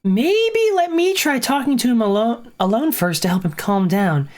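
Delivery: lifts high at the start of each phrase; lightly voiced game character. Character offering to talk to the bargainer, politely.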